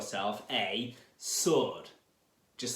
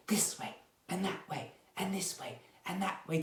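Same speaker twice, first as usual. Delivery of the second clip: soft, hushed whisper; 5 semitones higher, 6 dB quieter, faster than usual. Shown as a demonstration. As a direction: drop to a quick little whisper. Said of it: "we're gonna whisper".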